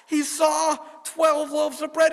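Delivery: slow, high, amazed narration; awed. A narrator's reading. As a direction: tell it with wonder. Awed discovery of bread.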